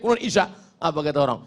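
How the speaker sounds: normal talking voice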